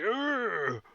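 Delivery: high, swooping groan; monster's pained groan. Acted. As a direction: groan like a monster hit from behind. Monster reacting to the blast.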